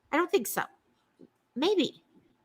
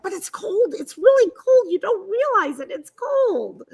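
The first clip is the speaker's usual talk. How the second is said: higher, mock-whiny complaining about the cold; whiny protest; 7 semitones higher, 3 dB louder than usual. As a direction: whine it like a kid insisting it's cold.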